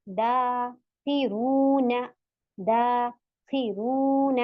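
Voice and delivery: दाखिरून with दा and रू held long, खि quick; very slow madd reading of दाखिरून; drawn recitation. Shown as a demonstration. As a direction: stretch रू in दाखिरून, keep खि short. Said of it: "रू खींचेगा क्योंकि यहाँ पे वाव मद है".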